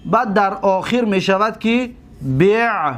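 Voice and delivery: ordinary talk, nothing marked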